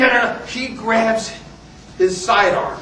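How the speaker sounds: ordinary talk, nothing marked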